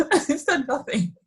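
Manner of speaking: repeating it through a laugh. Real, not acted. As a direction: say it again laughing.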